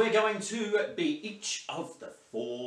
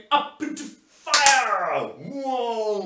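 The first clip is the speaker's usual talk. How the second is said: louder and higher, full of fire; energetic fire cue; loud fiery call; 7 semitones higher, 9 dB louder, much slower than usual. Shown as a demonstration. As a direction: blast it out like flames. Fire element in warm-up.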